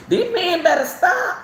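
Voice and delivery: laughing through a warning to abusive men. Real, not acted. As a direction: warn them while cracking up. Laughing warning about violent men.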